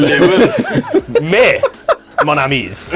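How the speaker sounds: put-on barking with a French accent; comic Frenchman mixing a woof with French. Told to bark in French, hammed-up French accent. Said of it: "In a French accent?".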